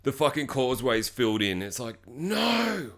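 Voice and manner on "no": long, drawn-out, softer no; slow wail of defeat, held back; mock despair. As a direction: stretch it out in hammed-up dismay. Dismay at blocked causeway.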